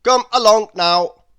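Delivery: loud, high and brisk; Mister Busy urging Lazy on, loud and brisk. A narrator's reading. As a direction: hurry him along impatiently. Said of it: "said Mister Busy".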